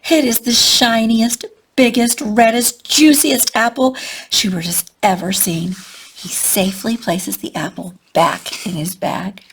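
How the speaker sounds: ordinary delivery, nothing marked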